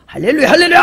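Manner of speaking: loud, high mock praise cry; mock hallelujah shout. Preacher mocking loud worshippers, shouted hallelujah. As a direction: ham up a fervent hallelujah. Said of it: "하고 뭐 방언 백 마디 한다고".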